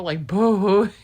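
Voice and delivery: a loud wailing boo, imitating her own ugly cry; playful imitation of sobbing on a plane; mock loud sob. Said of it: "Like, so loud".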